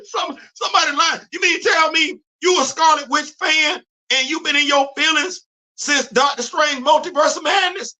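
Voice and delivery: high, shouted disbelief at the fans; exasperated rant. Real, not acted. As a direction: yell it like you can't believe them. Ranting at MCU fans.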